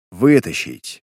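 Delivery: low, loud barked command; curt order. Acted. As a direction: snap the order out. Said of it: "отдал он приказ". (резкий приказ)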